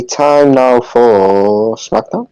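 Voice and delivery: normal talking voice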